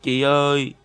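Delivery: drawn-out whimpering call to big sister; mildly pouty, pleading call; tearful plea. A narrator's reading. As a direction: plead as if about to cry. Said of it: "Bạn mếu máo".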